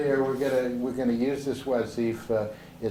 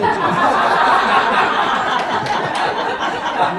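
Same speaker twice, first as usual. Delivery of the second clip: loud open laughter; big unvoiced laugh; hearty laugh; 10 semitones higher, 10 dB louder than usual. Real, not acted. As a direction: burst out laughing at the joke. Group laughing at the meal.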